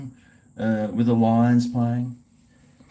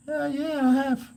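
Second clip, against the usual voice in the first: much higher, squeaky reply; 13 semitones higher than usual. Acted. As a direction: answer in a squeaky high voice. Answering in a high put-on voice.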